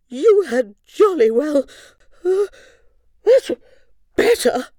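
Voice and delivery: sneezing queen. Acted. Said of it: "sneezed Queen Moo".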